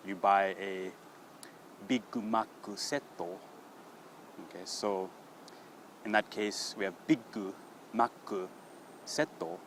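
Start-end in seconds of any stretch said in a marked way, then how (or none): 1.9-3.0 BIG MAC SET with an extra vowel closing each word; Japanese-style final vowels. Shown as a demonstration. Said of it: "there's often a vowel sound". 7.1-9.3 "Big Mac set" word by word as biggu, makku, setto; Japanese-style final vowels. Shown as a demonstration. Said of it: "we wanna cut that last sound".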